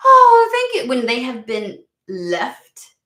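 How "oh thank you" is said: sudden high, loud, sarcastic oh thank you; mock grateful gush. Mocking people left with scraps, a loud, high fake thanks. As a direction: gush with overdone gratitude. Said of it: "expecting them to be like".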